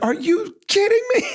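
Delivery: high, loud cry of disbelief; amazed disbelief. Retold or imitated. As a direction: shout it up high as the fireworks burst. Fireworks suddenly go off.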